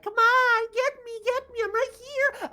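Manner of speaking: high-pitched, loud little familiar goading the enemy; taunting familiar. Acted. Familiar baiting the monster as Noko attacks, high excited yell. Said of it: "familiar here is like".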